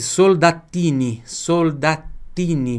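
Soldatini slow, clear Italian L; slow Italian demo. Shown as a demonstration. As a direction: model Soldatini slowly. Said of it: "L tem som de L na língua italiana". (Soldatini devagar com L italiano)

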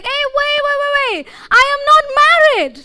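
very high, loud frantic realization; man falling past a floor, panicking as he realizes; panicked. Acted. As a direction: scream the sudden panic mid-fall. Jumper realizes mid-fall, panicking.

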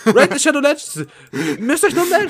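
high, loud ad read; ad announcer voice. Pretend. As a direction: hype it like a sponsor spot. Echoing sponsor ad.

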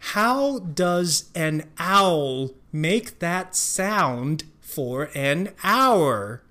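非常慢地一字一字念 How does an owl make that sound for an hour; 放慢速度把每个字念清楚; slow reading. Shown as a demonstration. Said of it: "slow then normal reading". (慢速示范整句)

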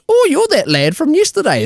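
high mocking old-lady voice; old Australian lady. Old lady greets him in the lift, mock old-lady voice. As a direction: put on a squeaky-high Aussie granny. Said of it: "in a fucking way worse voice".

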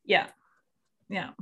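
ordinary delivery

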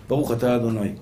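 low, soft recited blessing; hushed, deep-pitched prayer; murmured blessing. Real, not acted. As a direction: recite it quietly to yourself. Recites blessing aside, before drinking.

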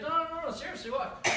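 high, loud mock pleading; acted panicky dog owner; frantic protest.

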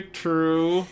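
words squeezed through clenched teeth; through gritted teeth. Acted. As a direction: force it out with the jaw locked. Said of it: "Chandler says through gritted teeth".

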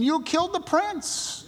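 high-pitched, strained retold accusation; exclaimed accusation. Preacher quoting Peter to the leaders, high exclaimed accusation. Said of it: "put a little exclamation point".

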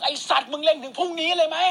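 high, mock-irritated complaint; impatient heckling. Acted impatient heckler waiting to tee off.

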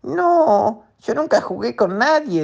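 high plaintive crab voice; high, sad little crab. Acted. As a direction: squeak it like a lonely little crab. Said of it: "dijo el cangrejo".

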